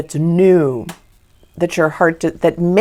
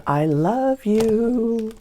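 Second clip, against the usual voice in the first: very slow, drawn-out loving words; tender declaration; 3 semitones higher, 3 dB quieter, much slower than usual. Acted. Someone coming out of the cold, slow tender confession. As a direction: stretch each word out tenderly. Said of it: "coming out of the cold and saying".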